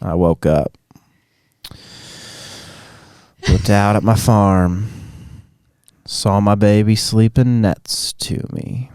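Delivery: very slow Southern drawl; hammed-up Southern country storyteller; Southern twang. Shown as a demonstration. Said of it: "slow it down and then add some twang".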